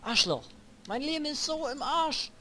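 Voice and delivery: high, loud raging yells; raging gamer. Real, not acted. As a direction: rage at the game. Back in fight, raging.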